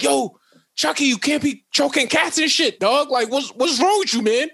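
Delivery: high-pitched comic telling-off; mock-dismayed scolding, pitched high; incredulous kid. Kid scolding the killer doll.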